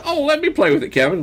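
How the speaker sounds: high mocking big brother voice; teasing older brother. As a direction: tease the little kid.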